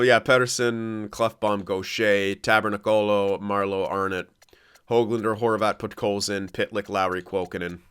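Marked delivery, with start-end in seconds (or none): none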